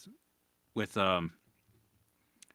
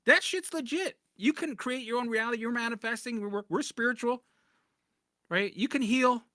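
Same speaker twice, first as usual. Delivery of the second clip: much higher mocking voice of a spiritual guru; 10 semitones higher than usual. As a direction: send up a starry-eyed manifesting type. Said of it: "mocking spiritual gurus".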